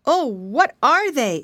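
slow, high, loud piggy voice; frightened question. A narrator's reading. Piggy boy asking about strange bangs, frightened. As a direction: ask in drawn-out alarm, as a piglet. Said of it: "almost as much frightened".

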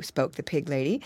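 usual speaking voice

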